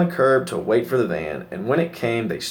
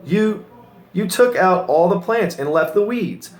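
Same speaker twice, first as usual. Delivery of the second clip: dismayed disbelief; 8 semitones higher than usual. Acted. Ben upset about the weeds.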